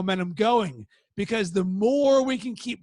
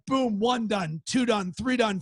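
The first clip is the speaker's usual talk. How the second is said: ordinary delivery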